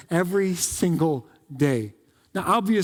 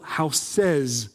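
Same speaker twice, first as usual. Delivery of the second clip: "houses" stretched out very slowly; stress on plural; 3 semitones lower than usual. Shown as a demonstration. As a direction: split "houses" into two long syllables to stress the plural.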